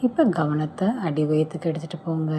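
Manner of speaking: ordinary talk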